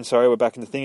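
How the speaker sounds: ordinary talk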